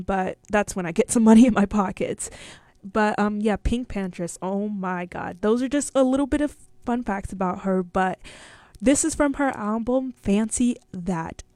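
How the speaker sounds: usual speaking voice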